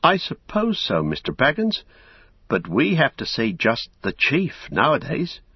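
put-on nervous old gatekeeper refusing politely; old Hob apologising. Old gatekeeper refusing Merry, high and anxious. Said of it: "old Hob".

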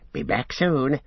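a sly creature slipping away; Gollum voice. A narrator's reading. Gollum speaks.

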